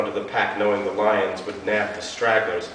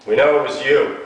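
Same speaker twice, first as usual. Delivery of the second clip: hefty confident cop; 5 semitones higher, 10 dB louder than usual. Acted. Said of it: "the man repeated".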